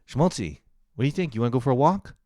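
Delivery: high, loud, eager questions; perky pet-owner voice, rising at the end; excited dog talk. Real, not acted. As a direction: rev up your dog for a walk. Exciting the dog about a walk.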